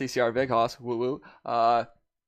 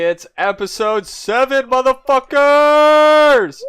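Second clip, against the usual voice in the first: big hyped-up yell; hype shout; 13 semitones higher, 11 dB louder, slower than usual. Real, not acted. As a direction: scream it to open the show. Hyped opening of the episode.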